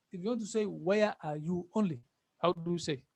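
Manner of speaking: normal talking voice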